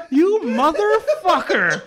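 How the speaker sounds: high, loud, drawn-out comic fury; retold wounded-pride outburst; mock outrage. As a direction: stretch it out, scandalized but laughing. Mock outrage at son's insult.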